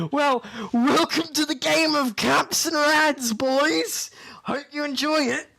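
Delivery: high, loud mocking welcome to intruders; gang leader greeting. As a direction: greet them like a smug raider boss. GM voices enemy leader greeting.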